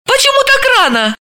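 high, startled and bewildered; blurted out in confusion; caught off guard. Acted. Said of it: "от неожиданности". (растерянно и удивлённо)

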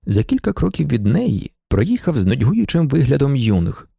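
ordinary delivery, nothing marked

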